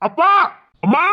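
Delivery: short shouted summons; calling out loudly. Acted. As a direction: yell for the parents. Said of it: "please கத்தாதீங்க".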